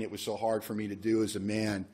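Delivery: normal talking voice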